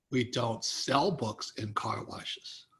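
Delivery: low, slow, dismissive refusal; gruff car wash owner. Retold or imitated. Author imitating the car wash owner turning him down, low and slow. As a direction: drag it out low like a skeptical owner.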